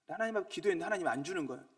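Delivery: ordinary delivery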